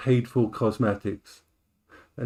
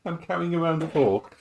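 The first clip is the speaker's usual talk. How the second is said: laughing at herself, high giggle; 6 semitones higher than usual. Real, not acted. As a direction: giggle through the words.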